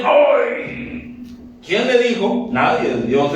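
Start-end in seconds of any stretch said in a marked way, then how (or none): 0.0-1.2 high yelp of someone stung; mock ouch. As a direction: yelp as if a sore toe was stepped on. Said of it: "pisó Juanete". (grito agudo de dolor fingido)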